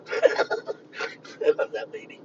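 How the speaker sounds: slow, breathy laughing that sounds almost like crying; laughing through words. Real, not acted. Recalling the heckler lady, laughing helplessly.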